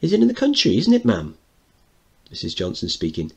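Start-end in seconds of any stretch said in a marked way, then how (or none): 0.0-1.4 put-on very high, loud boy voice; excited child. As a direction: ask like an eager little boy. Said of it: "Mickey speaking".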